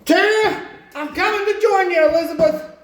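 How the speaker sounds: high, loud hammed-up cry to the heavens; mock dramatic cry. Hillbilly in milk tank hamming up a dying cry. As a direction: wail it out like a fake deathbed plea.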